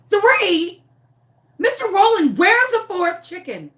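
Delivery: high, loud outburst of disbelief; alarmed demand. Retold or imitated. Owner confronting neighbor over missing fourth chicken, loudly alarmed. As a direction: demand it in an acted panic.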